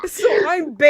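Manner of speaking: loud, laughing realization; loud exclamation. Real, not acted. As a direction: shout it out in disbelief. Exclaims realization of being bait.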